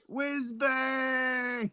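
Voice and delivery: high, drawn-out hail; calling out. Real, not acted. Host greets Ken by his nickname, calling out high. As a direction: call the nickname out long and high.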